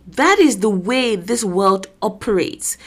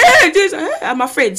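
acted fear; 6 semitones higher than usual. Person scattered by Satan's tricks, hammed-up fear. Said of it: "it's fear".

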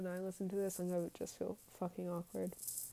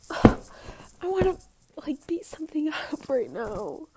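high, loud burst of frustration; exasperated outburst; pissed off; 6 semitones higher, 10 dB louder than usual. Real, not acted. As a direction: let real anger spill out, voice pushed up. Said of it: "I'm also fucking pissed".

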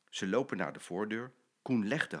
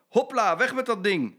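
cheerful loud Hopla; 10 semitones higher, 8 dB louder than usual. Acted. Papa kicks the poo.

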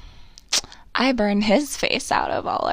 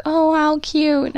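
"Oh, how cute" higher, louder and slow, doting; gushing "Oh, how cute"; 6 semitones higher, 6 dB louder, slower than usual. Retold or imitated. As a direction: coo "Oh, how cute" over the photos. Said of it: "Now I have to be like".

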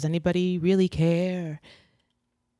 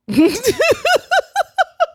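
shrieking burst of laughter; loud high laugh; 8 dB louder than usual. Real, not acted. After Coca-Cola shout-out.

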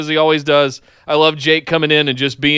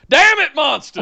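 mock Southern; 8 semitones higher than usual. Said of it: "when I said".

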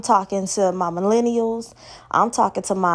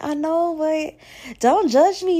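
whiny plea; 7 semitones higher, slower than usual. Retold or imitated. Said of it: "But I'm like".

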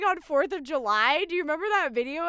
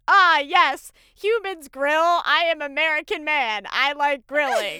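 mock alien; 3 semitones higher, 8 dB louder than usual. Mocking Mark Zuckerberg as an alien, loud stiff robot voice. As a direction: play a stiff alien faking being human. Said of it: "an alien being like".

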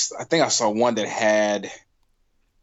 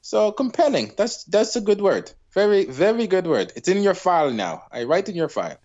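mock Irish doctor; 5 semitones higher than usual. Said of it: "God damn this accent".

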